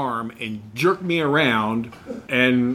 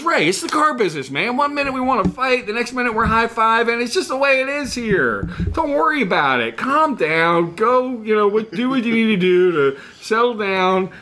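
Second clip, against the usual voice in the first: higher, upbeat pep talk from a cheerful boss; jovial boss; 6 semitones higher than usual. Retold or imitated. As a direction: laugh it off and cheer him up. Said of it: "and he goes".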